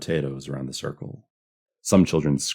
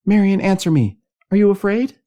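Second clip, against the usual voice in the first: very high, loud, urgent calling; worried woman's raised voice; anxious demand; 11 dB louder than usual. A narrator's reading. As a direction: demand an answer across the dark. Louise demanding answer from missing Marion.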